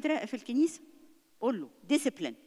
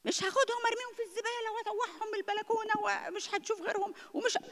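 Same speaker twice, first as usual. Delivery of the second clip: high, shrill-free overblown threat in a put-on voice; mocking an overreacting mother's threat; mock angry mother; 12 semitones higher than usual. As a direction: ham up a ranting mother threatening the toys. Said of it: "مش لغتي انا".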